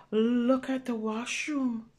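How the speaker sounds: ordinary delivery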